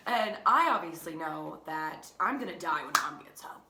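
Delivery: ordinary talk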